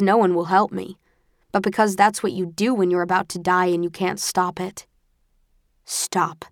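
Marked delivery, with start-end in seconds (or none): 5.8-6.4 understated stern command, not raised. A narrator's reading. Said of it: "a stern voice says".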